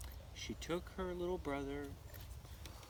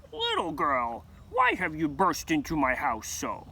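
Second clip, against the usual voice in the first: Baba Yaga's demand; 11 dB louder than usual. A narrator's reading. Witch Baba Yaga speaks.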